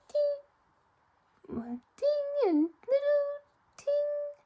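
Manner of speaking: high, slow chiming bell sounds; crossing bell. Acted. Storyteller ringing the crossing bell as the train passes. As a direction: ring it out like a little bell. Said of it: "every crossing bell said".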